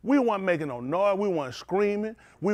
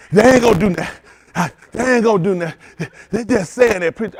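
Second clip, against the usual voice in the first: loud, sure they won't do it; loud scoffing doubt; 10 dB louder than usual. Retold or imitated. Doubter scoffing at the preacher, loud. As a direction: brush it off loudly, voice up. Someone doubts him loudly.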